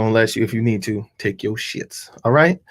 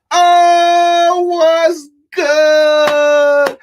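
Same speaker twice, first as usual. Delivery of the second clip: much higher and louder shouted greeting; hype shout; 11 semitones higher, 8 dB louder, much slower than usual. Acted. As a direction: yell it to a crowd. Hyped MC greeting.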